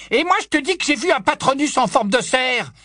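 high, insistent outcry; crying out. A narrator's reading. Death Eater insisting he saw a stag, crying out. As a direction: cry out in stubborn protest. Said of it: "s'écria le premier mange-mort".

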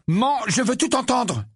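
high, whining plea; whined. A narrator's reading. Ginny begs to hear everything, whining. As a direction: whine to be allowed to stay. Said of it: "gémit Ginny".

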